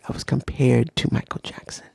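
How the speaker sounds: breathy low whisper of the line; low whispered aside. Shown as a demonstration. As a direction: hush it like a secret confession. Retells being compared as kid.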